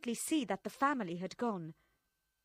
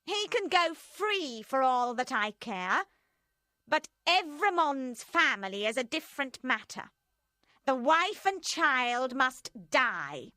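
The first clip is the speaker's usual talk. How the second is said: high and loud, slowing hard on the sentence; ruthless Madame Defarge; 4 semitones higher, 5 dB louder than usual. A narrator's reading.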